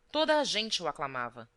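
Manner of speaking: ordinary delivery, nothing marked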